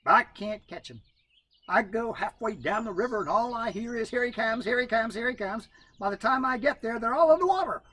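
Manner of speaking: high, rising complaint; Brother Coon voice. A narrator's reading. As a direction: whine out the trouble.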